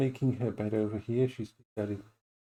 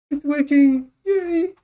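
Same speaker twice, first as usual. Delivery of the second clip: high, loud joyful cheer; excited cheer; 13 semitones higher, 8 dB louder, slower than usual. Real, not acted. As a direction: celebrate that it finally works. Tech finally works, cheering.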